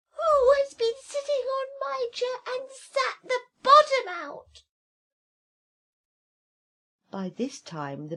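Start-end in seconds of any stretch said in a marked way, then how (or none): 0.1-4.6 very high, loud, furious little squeal; a tiny bear in a squeaky rage; teeny tiny angry voice. Acted. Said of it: "in a teeny tiny voice of anger".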